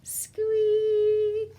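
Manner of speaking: very high tiny squeak; high mousey squeak; mouse squeak. Shown as a demonstration. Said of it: "Everybody, squeak".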